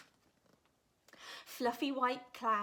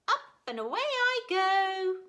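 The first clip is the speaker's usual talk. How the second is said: higher and louder; excited cry; 9 semitones higher, 10 dB louder than usual. Acted. Gooseberry trying to dance in the breeze, high loud cry. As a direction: launch it joyfully. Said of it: "Gooseberry cried".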